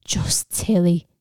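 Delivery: mocking echo. A narrator's reading. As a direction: throw the words back at him scornfully. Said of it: "she mocked".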